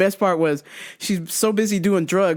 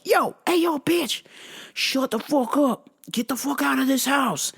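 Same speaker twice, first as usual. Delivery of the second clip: higher, hammed-up macho threat; mock tough-guy yell; 5 semitones higher than usual. The actor kicking his sister out, mock tough voice. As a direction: throw the guy out like a thug. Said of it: "He was like".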